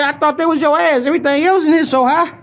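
high mock complaint; high customer voice. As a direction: squeak out the punchline. Punchline high voice.

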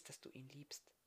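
ganz leise, fast tonlos geflüstert; Flüstern. Acted. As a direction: haucht es ihr tröstend zu. Trost, leise zugesprochen. („dass du ihn liebst“ tonlos geflüstert)

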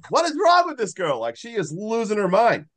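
high, incredulous what is wrong; mock baffled robber. Robbers stare at the starving girl, baffled and high. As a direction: gape at her in mock disbelief. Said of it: "looking at him like".

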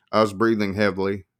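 usual speaking voice

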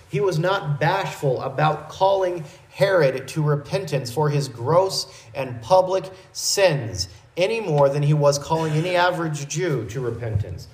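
ordinary talk, nothing marked

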